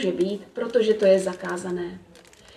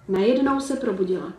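ordinary delivery

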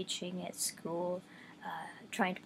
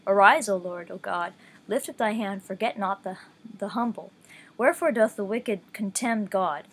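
a slightly louder, measured Bible read-along; scripture reading; 4 dB louder than usual. Acted. Reading Psalm ten aloud to listeners, measured scripture voice. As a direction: read the psalm with steady reverence.

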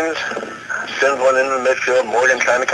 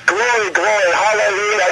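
high, lightly sung gospel praise; gospel choir; 8 semitones higher than usual. Retold or imitated. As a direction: chant it like a mock gospel choir. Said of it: "gospel choir would say".

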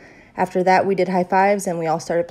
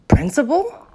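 high, rising shocked question; 9 semitones higher, 6 dB louder than usual. A narrator's reading. Girl questions the teacher, very shocked. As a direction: ask it in disbelief, pitched up. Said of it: "very shocked".

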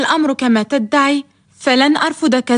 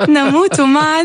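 laughing; 3 semitones higher than usual. Acted. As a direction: laugh through the words. Laughing declaration.

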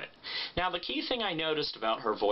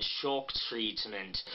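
low, slow, exaggerated "Shock Treatment"; exaggerated Pat Quinn vowels, softer; Pat Quinn impression; 5 semitones lower, 4 dB quieter, much slower than usual. Shown as a demonstration. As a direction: drop low and stretch "Shock". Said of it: "very low, very exaggerated".

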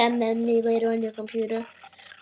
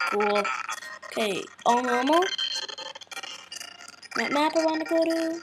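ordinary delivery

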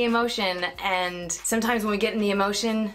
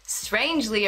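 ordinary delivery

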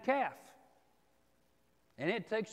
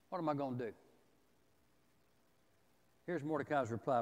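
ordinary delivery

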